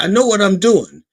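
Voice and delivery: mock-exasperated husband digging in; defensive insistence. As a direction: insist over the wife's objection. Husband snapping back at his wife.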